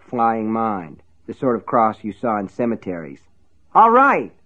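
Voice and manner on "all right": high, loud desperate cry. A narrator's reading. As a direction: blurt it out loud in surrender. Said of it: "he cried".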